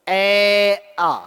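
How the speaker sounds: ए आ voiced from the throat, each vowel held out clearly; vowel demo. Shown as a demonstration. Teacher models the throat sound for the class: held ए आ. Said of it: "तो ए आ का उच्चारण करते हैं तो".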